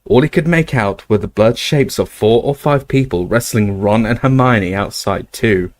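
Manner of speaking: ordinary delivery, nothing marked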